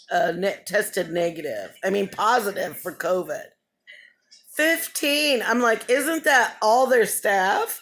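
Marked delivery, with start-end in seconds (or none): 4.5-7.8 high-pitched retold disbelief; pitched up, incredulous; shocked. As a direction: repeat the number in shock. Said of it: "I'm kind of in shock".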